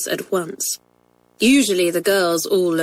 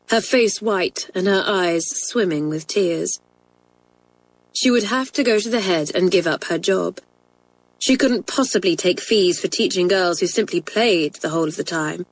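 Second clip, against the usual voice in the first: ordinary delivery, nothing marked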